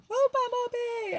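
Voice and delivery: high, drawn-out mock scream; retold scream. Mowing the lawn, screaming to warn a bumblebee, reenacted. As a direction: cry out in alarm for the bee. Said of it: "And I was, like, screaming".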